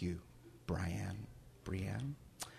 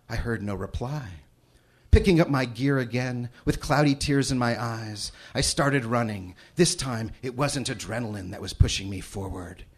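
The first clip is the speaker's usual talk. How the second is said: reading a novel passage with rising tension; dramatic story reading; 3 semitones higher, 6 dB louder than usual. Acted. Father reads his daughter's novel to the audience, building dramatic tension. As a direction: narrate the chase like it's happening now.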